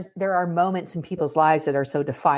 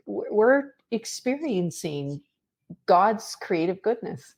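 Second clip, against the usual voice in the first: ordinary talk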